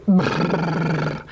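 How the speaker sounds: lips buzzing brrr, no vowel; a long lip trill brrr; bilabial trill. Shown as a demonstration. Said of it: "Most people can make that sound".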